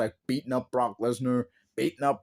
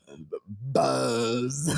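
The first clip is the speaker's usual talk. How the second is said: hesitant, stumbling buzz; stammered buzz; 4 semitones lower than usual. Retold or imitated. Mimicking Moxley stumbling onto the word buzz. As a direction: trip into the word. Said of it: "What am I supposed to say next?".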